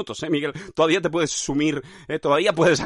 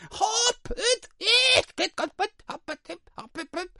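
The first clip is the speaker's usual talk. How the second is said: fading clown squeaks; slower than usual. Pretend. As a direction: let the silly squeaks die away. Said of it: "Era fiel a su oficio de payaso".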